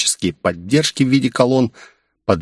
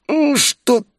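slow, very high strained wheeze; strangled, high voice of a man in agony; pained wheeze; slower than usual. A narrator's reading. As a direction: choke it out high, as if being crushed. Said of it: "захрипел он".

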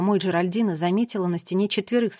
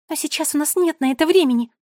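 high, loud, urgent protest; urgent, raised little voice; hurrying; 6 semitones higher, 5 dB louder than usual. A narrator's reading. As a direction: rush everyone along. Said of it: "торопила всех Мира".

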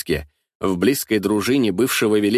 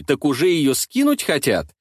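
usual speaking voice

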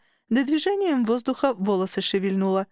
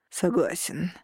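resigned, slightly lower agreement; understated weary male assent; heavy sigh; 3 semitones lower, slower than usual. A narrator's reading. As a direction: agree reluctantly as if sighing. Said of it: "с тяжёлым вздохом поддакнул Лей".

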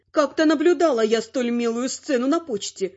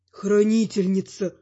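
hissed; 6 semitones lower than usual. A narrator's reading. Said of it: "зашипел Салик".

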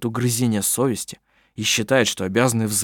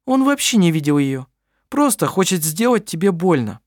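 firm dismissive woman; 7 semitones higher than usual. A narrator's reading. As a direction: shut the lie down. Clara continues firmly.

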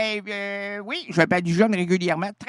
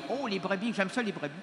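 usual speaking voice

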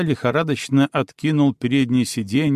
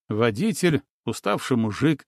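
ordinary delivery

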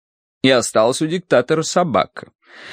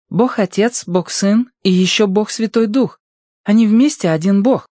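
high, insistent character voice; joining the argument; 10 semitones higher, 4 dB louder than usual. A narrator's reading. Proshka explains the Trinity to the boys, arguing in high voice. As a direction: break into the debate on a much higher pitch. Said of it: "ввязалась в спор Прошка".

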